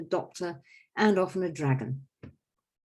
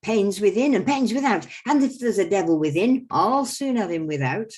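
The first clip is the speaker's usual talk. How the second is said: louder, higher boastful quack rhyme; the quack doctor boasting; 3 semitones higher, 8 dB louder than usual. Acted. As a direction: sell your cures like a fairground quack. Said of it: "So I go".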